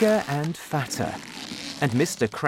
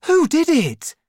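loud, high-pitched demand; father's excited high question; excited cry; 7 dB louder than usual. A narrator's reading. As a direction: cry it out, agog to know who. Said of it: "he cried".

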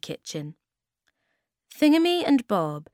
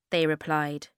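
ordinary delivery

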